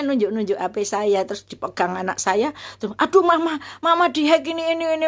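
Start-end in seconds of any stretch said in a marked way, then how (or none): 3.0-5.1 alarmed daughter. Retold or imitated. Daughter warns her mother the phone is hacked, alarmed. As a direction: act out a child's urgent warning.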